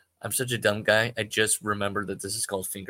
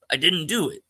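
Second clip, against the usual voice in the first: protesting; 8 semitones higher, 5 dB louder than usual. Acted. Joe protesting innocence.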